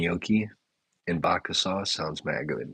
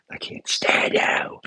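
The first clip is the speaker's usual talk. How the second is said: mock sob; 5 dB louder, slower than usual. Mock rant at chat commenter.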